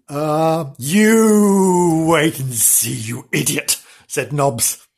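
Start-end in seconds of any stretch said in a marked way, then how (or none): 0.1-3.8 drawn out, slow threat; grumpy old dog warning; slow menacing dog. Acted. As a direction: stretch it out slowly. Nobbs voiced, slow.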